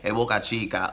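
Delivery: a light imitation of a Dominican calling out; Dominican accent. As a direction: call it out like a Dominican would, lightly. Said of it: "when they say it with the accent".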